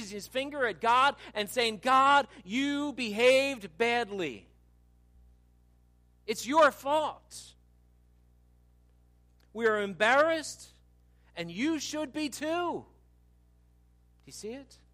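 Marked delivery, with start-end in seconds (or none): 1.8-12.8 slow, higher-pitched blaming complaint; acted bitterness, pointed and deliberate; bitter accusation. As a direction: draw out each accusing phrase. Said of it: "He's speaking bitter statements".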